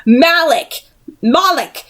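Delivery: commanding call. Acted. Will invoking his blade.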